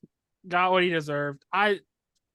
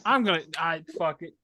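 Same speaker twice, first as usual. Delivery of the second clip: usual speaking voice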